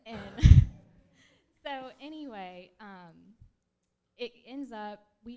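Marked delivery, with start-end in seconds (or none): 0.2-1.3 a loud, embarrassed laugh breaking out mid-story; burst of laughter. Real, not acted. Testifying woman laughs at herself in front of the church, loud laugh.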